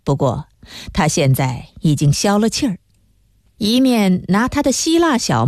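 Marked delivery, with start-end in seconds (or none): none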